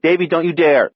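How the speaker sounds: high, loud sibling scolding; indignant warning. A narrator's reading. Sister warns her brother not to sting, high indignant warning. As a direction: warn him off sharply.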